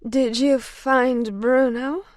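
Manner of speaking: slow, slurring drowsy words; slurred speech. Acted. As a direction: drag the words out half asleep. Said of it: "her words slurring".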